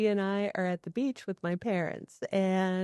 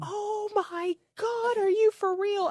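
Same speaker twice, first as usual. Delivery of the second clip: much higher, slow shocked gasp of a mother; shocked mom; 14 semitones higher, slower than usual. Retold or imitated. Mother in the elevator hears the shark tale, high shocked squeal. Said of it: "And she goes".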